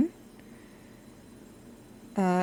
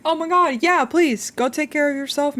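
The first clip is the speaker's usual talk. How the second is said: high, loud caring fuss; excited concern; 7 semitones higher, 6 dB louder than usual. Real, not acted. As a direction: gush over them warmly. Fussing over starving raider.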